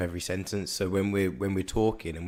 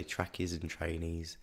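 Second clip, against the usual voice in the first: slow, softer Scouse imitation; imitated Scouse accent; 2 semitones lower, 9 dB quieter, slower than usual. Imitating a girl from work, soft slow Scouse accent. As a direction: imitate a Scouse speaker, drawing it out quietly. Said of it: "that's how she does it".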